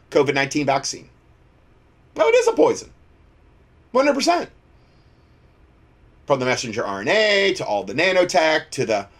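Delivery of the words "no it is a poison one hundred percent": emphatic. Real, not acted. Insisting vaccine is poison.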